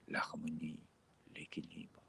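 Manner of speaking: normal talking voice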